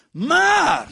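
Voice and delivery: one high, booming shouted word; dramatic shout. Acted. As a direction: shout the turning word, then stop. Dramatic turn in the sermon. (luide dramatische uitroep)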